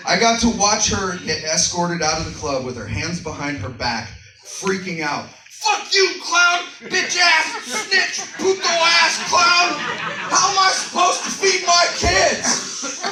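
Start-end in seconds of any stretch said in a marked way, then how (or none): 5.5-13.0 furious screaming stripper. Retold or imitated. Fired stripper screaming at the clown, high shrill furious yell. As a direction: scream abuse as you're dragged out. Stripper cursing while escorted out.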